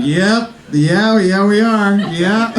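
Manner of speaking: forced agreement. Retold or imitated. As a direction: humor her, unconvinced. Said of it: "I was like".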